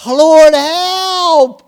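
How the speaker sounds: high, drawn-out cry; yelled plea. Shown as a demonstration. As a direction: yell out for help. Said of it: "when you yell out loud enough".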